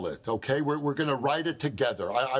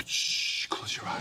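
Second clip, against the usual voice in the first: hushed whisper, unvoiced; 4 dB quieter, slower than usual. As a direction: breathe it softly right in your ear. Hushing her to imagine.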